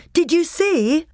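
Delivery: high, loud, eager question; excited boy asking his brother. A narrator's reading. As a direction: burst out with the news. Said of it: "cried Edmund".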